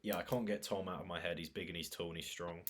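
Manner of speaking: ordinary talk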